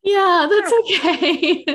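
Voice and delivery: high, slow, bright laugh through the words. Real, not acted. Guest laughs along as the host gets emotional, laughing. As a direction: laugh it out, touched and amused.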